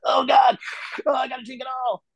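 like a vampire finally tasting blood; patient craving water like a starved vampire. Retold or imitated. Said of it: "like a vampire that hadn't drank blood".

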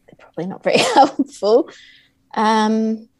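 ordinary delivery

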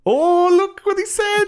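put-on squeaky high kid voice, slow and wide-eyed; mock little kid. A kid watching the film, hammed-up high kid voice. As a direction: play a shocked eight-year-old tattling. Eight-year-old reacting to swearing.

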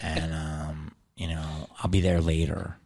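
low and soft, hesitant brush-off; sheepish excuse. Retold or imitated. Comic imitates writing partner putting off work, low and sheepish. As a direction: imitate someone casually wriggling out of plans.

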